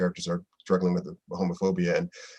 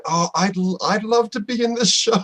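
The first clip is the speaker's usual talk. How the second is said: excited wish; 11 semitones higher, 4 dB louder than usual. Retold or imitated. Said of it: "I was directing it going".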